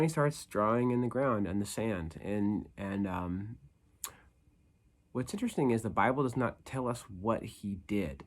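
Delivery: ordinary delivery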